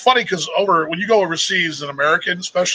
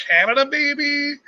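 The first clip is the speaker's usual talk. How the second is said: higher, drawn-out questioning guess; imitated puzzled guess; 9 semitones higher, slower than usual. Australians guessing where the accent is from, imitated puzzled guess. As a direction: mimic someone slowly hazarding a guess. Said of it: "they think".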